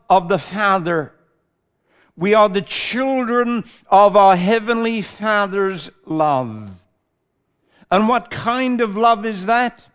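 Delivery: fervent preaching. Real, not acted. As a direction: preach it out with conviction. Preaching at full force.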